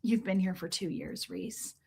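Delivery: low, quiet put-down; dry, judgy and low; mom's deadpan jab. Retold or imitated. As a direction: let it sink low and quiet. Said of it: "My mom did the same thing".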